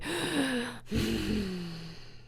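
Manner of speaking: big audible breath in; deep breath in. Acted. Wilbur following Charlotte's instruction, deep inhale. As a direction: draw in one long deep breath. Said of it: "Wilbur breathed deeply".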